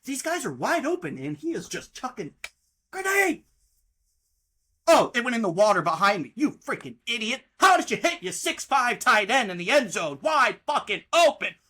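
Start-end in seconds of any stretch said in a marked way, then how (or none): 2.9-5.1 outraged yelp. Real, not acted. Ranting about a bad throw. 6.4-11.6 exasperated, loud rant; angry yelling. Real, not acted. Yelling at the quarterback.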